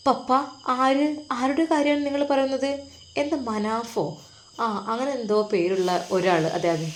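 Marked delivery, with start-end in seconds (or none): 0.1-4.0 shocked question. Acted. Daughter shocked by papa's news.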